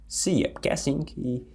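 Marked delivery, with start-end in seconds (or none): 0.1-0.5 "see ya" ending in a relaxed "ya"; casual see ya. Shown as a demonstration. Teacher showing everyday speech, casual "see ya". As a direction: say "see ya" with a light schwa ending. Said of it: "o jeito que a gente fala".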